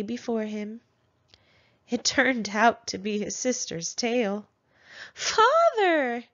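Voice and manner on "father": high, loud shrieking cry. A narrator's reading. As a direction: scream for father in alarm. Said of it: "she shrieked".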